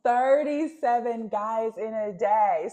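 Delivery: slow, loud, high disbelief; incredulous repeat. Retold or imitated. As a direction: stretch out each word in astonishment. Repeats clip's claim in disbelief.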